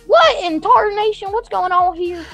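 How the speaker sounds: hammed-up West Virginian yokel; West Virginian accent. Streamer reacting to the potato in a mock West Virginian accent. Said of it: "I didn't mean to talk in the West Virginian accent".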